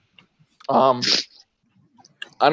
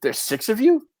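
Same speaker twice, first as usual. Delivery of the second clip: higher pitched flustered doorman counting heads; doorman objecting; 8 semitones higher than usual. Acted. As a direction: stop them, suddenly doubtful. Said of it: "He goes".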